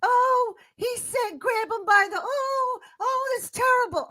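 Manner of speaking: high, mock-shocked pearl-clutching voice; prissy outraged woman. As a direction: squeal it like a fake-offended lady. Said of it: "those women that was like".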